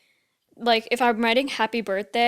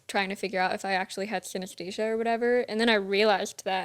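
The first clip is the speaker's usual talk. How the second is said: normal talking voice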